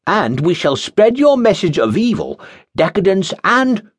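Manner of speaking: high, grand, groping delivery; pompous acolyte. Acted. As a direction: grandstand while fumbling. Pompous Jeremiah leaving.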